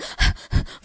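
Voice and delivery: panting, out of breath; quick gasps of a runner; exhausted gasp. Retold or imitated. Storyteller mimics the exhausted hitchhiker, panting.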